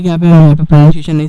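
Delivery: loud aside. Real, not acted. Teacher cuts off the pronunciation aside.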